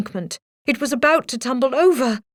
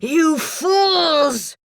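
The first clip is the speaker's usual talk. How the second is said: higher furious cry; angry cry; 7 semitones higher than usual. A narrator's reading. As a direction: yell at the crooks in fury.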